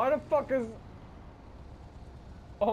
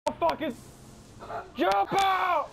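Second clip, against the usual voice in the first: urgent shout; 11 semitones higher than usual. Real, not acted. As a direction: yell to jump out now. Shouting to bail.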